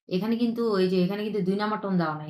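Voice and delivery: ordinary talk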